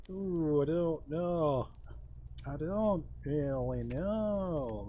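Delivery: slow, drawn-out mock Yorkshire; Yorkshire accent. Gamer dithering aloud over a choice, in put-on Yorkshire. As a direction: put on Yorkshire, stretching the vowels. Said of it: "I've gone Yorkshire there".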